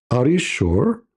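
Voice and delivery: usual speaking voice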